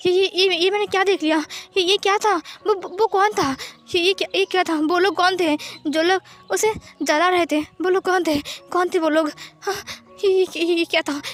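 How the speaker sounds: high, frightened stutter; panicked stammering. Acted. Wang ji sweating after a nightmare, panicked stammering. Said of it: "बहुत ज़्यादा डर लग गया".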